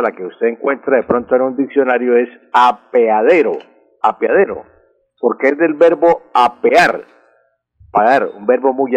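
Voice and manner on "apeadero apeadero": "apeadero" with a clear E, a-pe-a-de-ro; "apeadero" twice, E as a separate syllable; correct apeadero. Shown as a demonstration. Language expert giving the dictionary word, "apeadero" pronounced with E. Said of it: "la palabra correcta".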